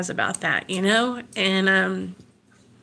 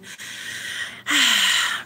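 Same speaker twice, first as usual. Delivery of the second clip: weary sigh; 3 dB quieter than usual. Real, not acted. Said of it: "sometimes it gets overwhelming".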